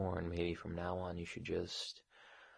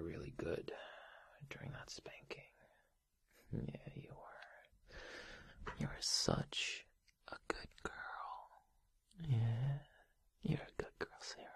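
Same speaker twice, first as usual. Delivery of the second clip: soft, breath-only approval; whispered praise; 3 semitones higher, 8 dB quieter than usual. Acted. Boss praising employee after punishment, soft whisper.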